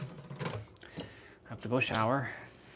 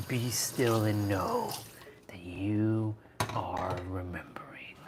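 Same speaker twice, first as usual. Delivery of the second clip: slow, whispered spiritual line; mock guru whisper; 5 semitones lower, 6 dB quieter, slower than usual. Streamer whispering mock wisdom to the chat. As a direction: breathe it out like a sage. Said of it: "I talk like that a lot".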